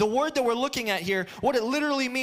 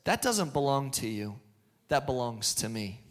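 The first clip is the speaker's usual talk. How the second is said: God's voice; 9 semitones lower, 3 dB quieter than usual. Acted. God laying claim to our worries, in a low, measured voice. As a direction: lower the pitch, a quiet pronouncement. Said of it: "When God says".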